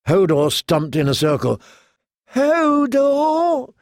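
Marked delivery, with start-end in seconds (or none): 2.3-3.7 very high, drawn-out whimper; small-voiced whimper. A narrator's reading. Hodor huddled amid ruin and death, small whimper. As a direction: whimper it thinly, far above normal pitch. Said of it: "he whimpered in a small voice".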